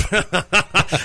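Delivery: quick amused chuckle; short laugh. Real, not acted. Host laughs at caller.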